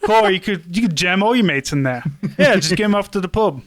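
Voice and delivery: hammed-up British soldier in Cockney; mock Cockney. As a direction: put on a chummy Cockney lad. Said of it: "sound like you're trying to be Cockney".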